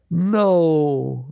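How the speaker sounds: normal talking voice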